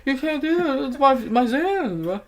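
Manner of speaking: very high, loud mock wail; whining protest. Boyfriend begging his girlfriend for his Xanax, mock whining. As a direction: plead in an exaggerated high whine.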